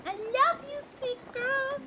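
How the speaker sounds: very high, soft pet voice; tender squeaky coo. Real, not acted. Cooing to her cat.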